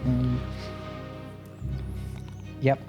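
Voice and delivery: ordinary talk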